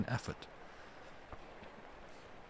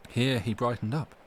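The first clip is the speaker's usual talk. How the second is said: ordinary delivery, nothing marked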